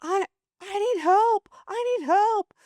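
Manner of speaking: high-pitched mock cry for help; imitated panic. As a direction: imitate a panicking swimmer, voice jumping way up. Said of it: "what do you mean by freaking out?".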